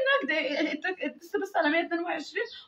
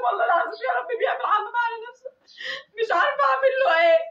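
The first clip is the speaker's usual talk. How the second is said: tearful, raised, fast, breaking into sobs; real crying; 4 semitones higher, faster than usual. Real, not acted. Crying over her son. (بكاء حقيقي بصوت عالي)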